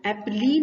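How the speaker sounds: एप्पली with the final E voiced as "lee"; spelling mispronunciation. Shown as a demonstration. Teacher showing what we cannot say, एप्पली ending in "lee". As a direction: read the last letters literally, एप्पली. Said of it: "नहीं बोल सकते हम उसे".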